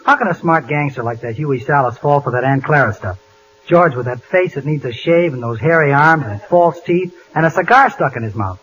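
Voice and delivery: normal talking voice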